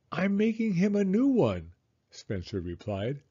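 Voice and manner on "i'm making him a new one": put-on high-pitched child voice; protesting kid. A narrator's reading. Son defending a headless toy, high protesting voice. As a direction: answer back as a small boy. Boy answers mother back.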